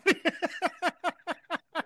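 high breathy laugh after the impression; real laughter, high and soft; laughing hard. Real, not acted.

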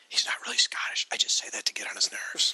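whispered joking aside. Real, not acted. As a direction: hush it like a secret, teasing. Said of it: "joking aside".

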